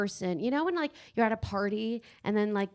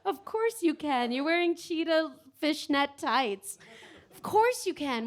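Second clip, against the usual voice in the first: teasing; 4 semitones higher, slower than usual. Acted. Teasing audience member.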